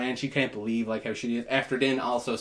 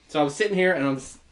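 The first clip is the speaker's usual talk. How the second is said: usual speaking voice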